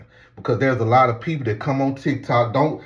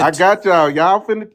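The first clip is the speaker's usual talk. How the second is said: raised voice, higher and louder; calling for order; 7 semitones higher, 6 dB louder than usual. Real, not acted. Host promising panel their turns, raised voice calling for order. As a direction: talk over the squabbling to take back the floor.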